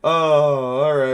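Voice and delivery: ordinary talk, nothing marked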